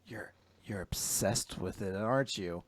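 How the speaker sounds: hushed, whispered insinuation; sly whisper. Retold or imitated. Maid Girl teasing Fumino about the plushie, whispered. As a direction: lean in and needle her quietly.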